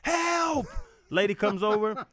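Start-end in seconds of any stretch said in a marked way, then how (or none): 0.0-0.7 hammed-up cry for help, pitched way up; mock scream. Friend ignores the worried question, screaming for help. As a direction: imitate a friend's high-pitched holler. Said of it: "he just starts screaming".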